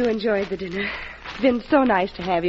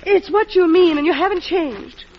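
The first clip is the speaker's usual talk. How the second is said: higher, rising indignation in defence of her husband; upset protest; 8 semitones higher than usual. Acted. Wife defending her husband to the president, indignant. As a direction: push back hard, hurt and indignant.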